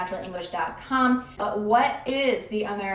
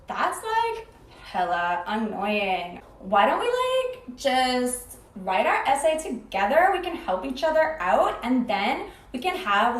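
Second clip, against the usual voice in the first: put-on Californian valley-girl accent. Shown as a demonstration. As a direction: exaggerate a Californian "like"-filled sing-song. Unannounced Californian valley girl demo.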